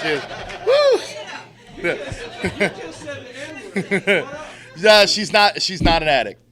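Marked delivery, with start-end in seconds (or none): 0.7-4.7 real whooping laugh that starts very high. Real, not acted. Joking about being married, a real whooping laugh. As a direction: let out a whoop, then laugh.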